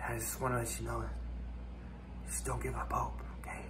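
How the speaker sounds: hushed, urgent whisper. Acted. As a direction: whisper as if still hiding. Whispering after fleeing a bear.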